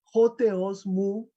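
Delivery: "Joteos Mu" said slowly, each syllable clear; slow Greek reading. Shown as a demonstration. Teacher quoting the Greek cry to the class, slow and careful. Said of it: "Dios mío".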